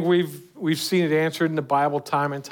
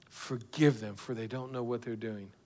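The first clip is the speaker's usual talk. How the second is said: much softer, near-whispered repeat; hushed echo; 5 semitones lower, 11 dB quieter than usual. Acted. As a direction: let the prayer fade into a hush. Repeated prayer, falling to hush.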